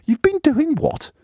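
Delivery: high-pitched, drawn-out disbelief; acted astonished questioner, voice leaping up; incredulous. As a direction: react as if you can't believe your ears. Mock interviewer reacts to the answer.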